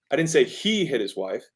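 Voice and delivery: "he" lifted above the other words; emphasis falls on "he"; stress on HE. Shown as a demonstration. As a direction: punch the word "he". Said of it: "The emphasis is".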